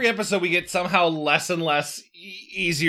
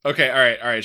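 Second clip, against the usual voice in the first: ordinary delivery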